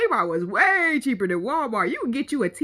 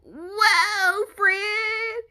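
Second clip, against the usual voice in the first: high, stretched-out reaction; exaggerated disbelief; 7 semitones higher than usual. Real, not acted. Warning a friend about red flags, exaggerated disbelief. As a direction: draw it out high, almost sung.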